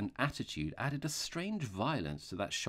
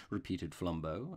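ordinary delivery, nothing marked

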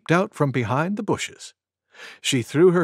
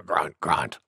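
low, soft grunting; boar grunts; 12 semitones lower, 6 dB quieter than usual. Acted. Wild Boar settles after her sneeze, low soft grunts.

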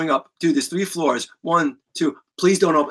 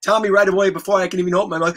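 ordinary talk, nothing marked

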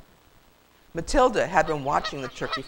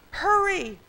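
high urgent call; high-pitched holler; 4 dB louder than usual. A narrator's reading. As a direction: call a warning from afar. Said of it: "a voice called out".

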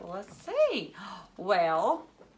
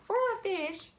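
playful wonder; 12 semitones higher than usual. Pretend. Repeats the answer in wonder.

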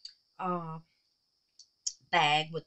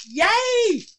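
excited yay; 10 dB louder than usual. Real, not acted. Delighted at a witch ornament.

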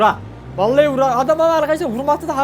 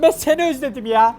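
ordinary talk, nothing marked

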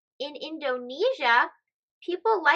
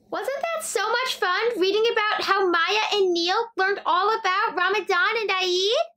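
enthusiastic host; 5 semitones higher, 3 dB louder than usual. Real, not acted. Host addresses child viewers.

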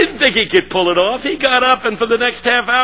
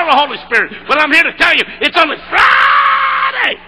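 preaching at full force; 7 semitones higher than usual. Shown as a demonstration. Speaker urging the crowd with a full shouted sermon. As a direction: pour everything into the punchline. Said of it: "I'll give you one more shot".

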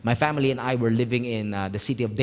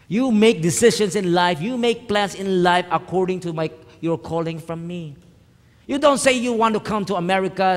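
high, forceful scolding; raised-voice, emphatic admonishment; stern divine rebuke; 7 semitones higher, 5 dB louder than usual. Retold or imitated. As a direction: lay down the law as God correcting a pastor. Acted rebuke from God.

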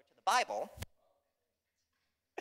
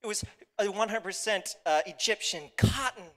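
hammed-up lament, raised pitch; mock crying; 6 semitones higher, 6 dB louder than usual. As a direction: whine over the lost clothes, dragging the last words. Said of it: "Puss begins to cry".

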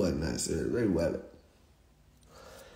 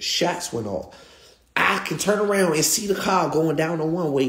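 ordinary delivery

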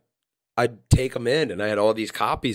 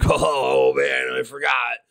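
a light, dopey stoner drawl; slower than usual. Retold or imitated. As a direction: play the spaced-out stoner friend, lightly. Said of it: "we always had this funny stoner voice".

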